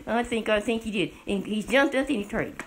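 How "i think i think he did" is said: ordinary talk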